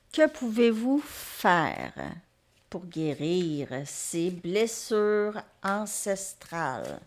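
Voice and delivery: drawn-out, ceremonial asking; very slow, measured invocation; slow, deliberate question. Real, not acted. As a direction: pose it solemnly, stretching every word. Solemnly putting her question to cards.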